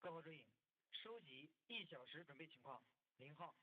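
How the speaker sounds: commanding radio roll call; mission control radio. Real, not acted. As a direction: announce over the loop.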